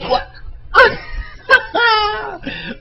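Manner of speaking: wheezing high laugh; high crying laugh. Real, not acted. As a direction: laugh till it squeaks. Laughs hard.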